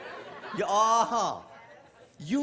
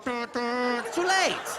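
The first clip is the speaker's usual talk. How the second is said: very slow, loud drawn-out wail; mock wife wail; 3 semitones higher, 4 dB louder, much slower than usual. Wife wailing after the crash, slow loud mock lament. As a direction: drag it out like a scolding wife.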